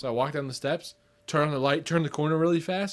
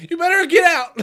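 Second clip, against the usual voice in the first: much higher, loud cracking threat; mock threat; 11 dB louder than usual. Teasing friend imitates his threat, high and loud. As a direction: squeak out a tough warning. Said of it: "You'd be like".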